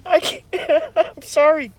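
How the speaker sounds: high, laughing through sorry; laughing apology. Real, not acted. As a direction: apologize while still cracking up.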